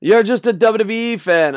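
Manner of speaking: high, loud fan jeer; mocking attacking fans. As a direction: throw it out like an accusing fan. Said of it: "they just attack A E W".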